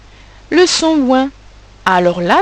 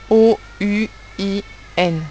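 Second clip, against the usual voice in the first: letter by letter. Shown as a demonstration. Teacher spelling the second way of writing it, letters separated. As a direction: spell "O U I N" with a pause after each. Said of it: "de la deuxième écriture".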